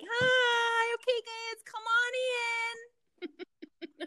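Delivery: high, strained fake-cheerful parent calling kids in; nervous parent. A parent hurrying the kids indoors, nervous fake cheer. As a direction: sing out a tense, too-bright welcome to pull the kids back. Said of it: "they're like".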